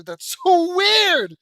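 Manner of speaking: high, loud, drawn-out exclamation; laughing cry, stretched way out and pitched high; incredulous outburst. Real, not acted. Reader reacting to an odd story line, incredulous outburst.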